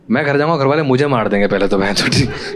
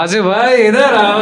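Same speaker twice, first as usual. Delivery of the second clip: calling out across the street; 9 semitones higher, 4 dB louder, slower than usual. Retold or imitated. Friend calls him out loudly.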